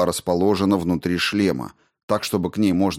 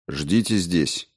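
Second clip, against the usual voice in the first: slow, measured command; firm order; slower than usual. A narrator's reading. Major tells Rao and Jensen to wait, firm order. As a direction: give a drawn-out, steady order. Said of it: "велел он".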